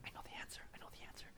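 urgent whispered I know; eager whisper. Acted. Peter whispering eagerly to himself, hushed. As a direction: whisper it, bursting to answer.

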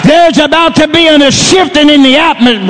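full-force Pentecostal sermon delivery; fervent preaching. Real, not acted. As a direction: shout the promise out to the whole congregation.

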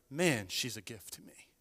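quiet, tender thankfulness; soft grateful. Retold or imitated. Husband thanking God, soft and tender. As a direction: murmur it like a grateful prayer.

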